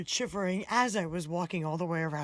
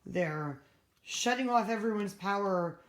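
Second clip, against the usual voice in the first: ordinary talk, nothing marked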